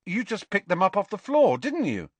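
accusing. A narrator's reading. Ron and Harry quarrel.

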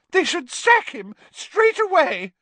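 very high, loud tearful outburst; Pansy in tears. A narrator's reading. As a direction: wail it out in tears. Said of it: "who was in tears".

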